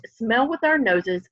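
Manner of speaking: usual speaking voice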